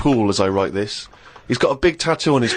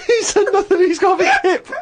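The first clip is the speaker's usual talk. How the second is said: high and louder through laughter; laughing; 4 dB louder than usual. Real, not acted. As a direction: shout it while cracking up. Laughing at Karl.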